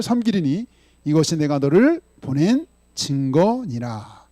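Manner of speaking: slow, loud recitation of the verse; slow, loud congregational recitation; unison scripture reading. Acted. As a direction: read it out together, measured and strong.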